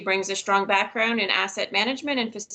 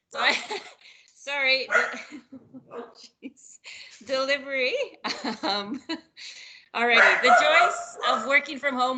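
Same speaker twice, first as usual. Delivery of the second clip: talking through real giggles; can't stop laughing; 4 semitones higher than usual. Real, not acted. Speaker apologizing to colleagues for a botched delivery, laughing. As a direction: try to carry on while cracking up, higher pitched. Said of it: "I can't stop laughing".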